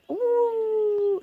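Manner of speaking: playful high-pitched vocal sound; imitating Chloe's voice play. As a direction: squeal up into a high ooh like her daughter. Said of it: "she plays with the tone of her voice".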